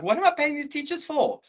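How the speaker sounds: high-pitched mock outrage; squeaky-high indignant quote; indignant complaint. Acted indignant complaint about teachers.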